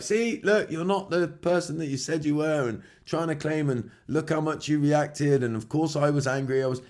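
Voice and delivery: high, lightly put-on whiny voice; mocking the toxic person. Imitating the toxic person blaming you, mildly whiny mock voice. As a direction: mimic them twisting it back on you. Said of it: "they'll then be like".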